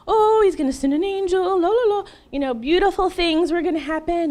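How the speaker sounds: high sing-song daydream; mock naive hope. Woman mocking her hope that God sends an angel, naive sing-song. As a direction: gush dreamily in a high voice, sending up your own innocence.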